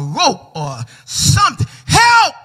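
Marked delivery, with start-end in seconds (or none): 1.9-2.3 high loud call for help; stranded man yells help; cry for help. Acted. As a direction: yell up from the cliff. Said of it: "Keith cried over and over".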